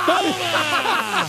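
high, loud "take that" cry; gleeful shout. Real, not acted. Co-host dealing a shock to a contestant, gleeful shout. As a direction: shout it as the jolt lands.

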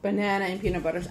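normal talking voice

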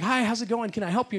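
high, chirpy bank-teller hello; bright cheery greeting. Retold or imitated. Bank worker greeting a customer, high and cheery.

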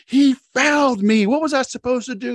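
mock furious jockey protest; angry protest. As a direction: plead it like a hot-headed young rider. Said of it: "you know, he's angry".

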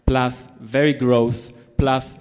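normal talking voice

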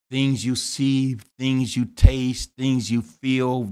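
slow, weighted repeated THINGS; slow preaching list. Acted. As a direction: lean on each item slowly. Sermon list.

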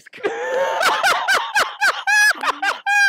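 high, loud shrieking laugh; real screaming laughter. Real, not acted. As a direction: let out a howling laugh. Bursts out laughing.

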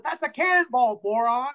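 high, exasperated insult; scornful bar owner. Acted. Bar owner mocking a customer's claim, scornful high voice.

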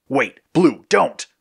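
high, urgent narrated shout; shouted warning. A narrator's reading. Red trying to stop Blue recalling, shouted. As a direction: call out to stop him, pitch jumping up. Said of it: "Red shouted".

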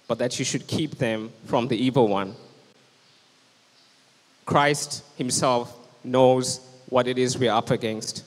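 ordinary talk

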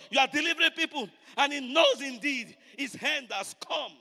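loud, driving preacher delivery; full-force preaching. Real, not acted. Preacher warns congregation the devil attacks the word, full-force preaching. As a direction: drive each phrase home to the congregation, loud.

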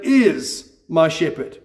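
"is" drawn out, then a pause before the rest; slow, certain emphasis on "is"; stress on IS. Shown as a demonstration. Preacher stating a truth in this moment, stress on "is". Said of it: "It's not an if or a but".

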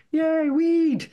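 very high, loud squealed cheer; mock festival cheer. Activist imitating revellers back in another field, high mock cheer.

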